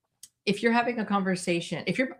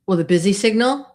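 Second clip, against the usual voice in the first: ordinary delivery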